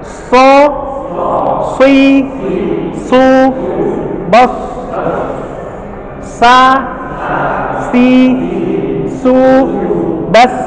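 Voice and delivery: So, si, su, bas with airy s; breathy s drill. Shown as a demonstration. Teacher drilling breathy s syllables. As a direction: let air hiss on So, si, su, bas. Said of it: "nafas yang kebuang".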